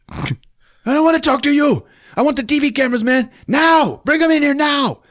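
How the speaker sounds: high, loud ranting thug voice; mock villain yell. Gunman yelling at hostages, high mock shout. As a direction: screech it like a crazed gunman.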